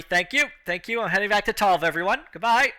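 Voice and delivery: acted cheery sign-off; departing winner. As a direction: call out breezy thanks while leaving. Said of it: "And she's just".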